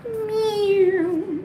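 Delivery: high, long falling meow; imitated meow. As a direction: mimic a cat with a drawn-out cry.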